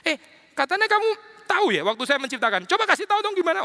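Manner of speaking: high mocking taunt; God teasing Job in a high, mocking voice. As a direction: needle him playfully, voice climbing high. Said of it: "ngeledek lagi ya".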